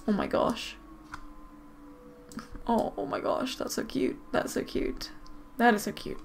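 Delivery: very soft, near-whispered cooing; hushed adoration. Real, not acted. Player watching the adorable foal, hushed and adoring. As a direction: breathe it out, melting at the cuteness.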